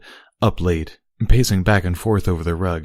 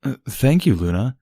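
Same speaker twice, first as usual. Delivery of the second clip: unsettled; 7 semitones higher than usual. A narrator's reading. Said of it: "said in an unsettled tone".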